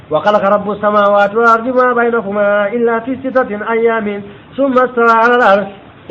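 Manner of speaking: fast chanted Arabic verse; rapid Quran recitation. Shown as a demonstration. Preacher to his audience, racing through the Arabic verse. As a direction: rattle off the verse in a recitation cadence.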